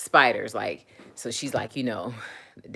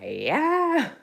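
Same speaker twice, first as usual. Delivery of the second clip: very high, drawn-out startled cry; imitated frightened yelp; 3 dB louder than usual. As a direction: yelp like dad seeing a snake. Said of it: "He'd be like".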